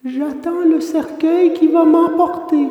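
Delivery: put-on high, ghostly little girl; otherworldly little voice. A narrator's reading. Blue-haired child at the window to Pinocchio, ghostly little voice. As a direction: speak as if from the other world, small and high. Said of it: "d'une petite voix qui semblait venir de l'autre monde".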